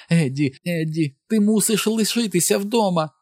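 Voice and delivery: high, hysterical run of fears; distraught wife pleading at a high pitch; wailing. A narrator's reading. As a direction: wail on and on in rising panic. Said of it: "залементувала вона".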